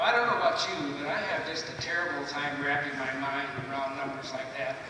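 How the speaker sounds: ordinary talk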